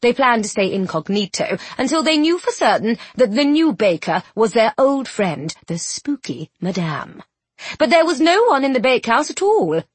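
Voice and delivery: ordinary delivery, nothing marked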